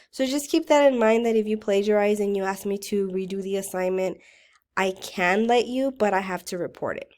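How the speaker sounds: ordinary talk, nothing marked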